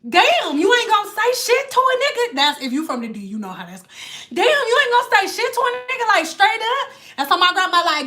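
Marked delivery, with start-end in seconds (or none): 0.0-2.4 quick, higher-pitched offended come-on; rushed, put-on indignation; mock indignant catcaller. Dude complaining at being ignored. 4.3-6.9 quick, higher-pitched offended come-on; rushed, put-on indignation; mock indignant catcaller. Dude complaining at being ignored.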